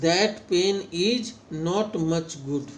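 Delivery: "that pen is not much good" with short vowels, slowly and clearly. Shown as a demonstration. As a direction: clip each vowel short. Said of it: "और short vowel में". (छोटे स्वर, साफ़ और धीमे)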